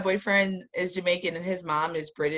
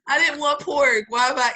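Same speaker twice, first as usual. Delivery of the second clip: mock dismay; 6 semitones higher, 3 dB louder than usual. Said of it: "And I'm like".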